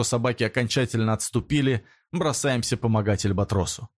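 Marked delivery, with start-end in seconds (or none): none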